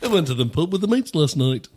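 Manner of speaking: nasal, hammed-up British accent; bad Liverpool-style British accent through a blocked nose. American does it his own way in nasal British accent. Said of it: "Isn't that what [name] sounded like?".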